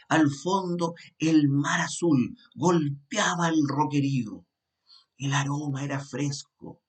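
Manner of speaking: slow, vivid once-upon-a-time telling; storytelling. Shown as a demonstration. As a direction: paint the castle scene slowly for the listener. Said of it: "le pongo color a la historia".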